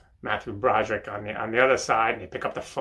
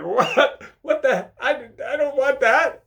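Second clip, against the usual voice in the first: startled Matthew Broderick; 14 semitones higher, 7 dB louder, slower than usual. Retold or imitated. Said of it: "Matthew Broderick's like".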